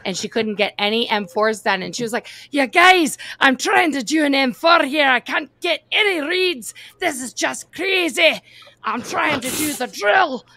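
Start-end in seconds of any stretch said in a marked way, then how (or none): 2.5-10.5 high, loud, imitated Scottish complaint; exasperated Scottish accent. Retelling a trainee begging laughing colleagues, in exasperated Scottish. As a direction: mimic a fed-up Scottish woman, pitched way up.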